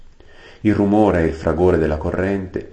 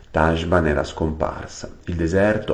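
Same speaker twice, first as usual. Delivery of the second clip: plain reading voice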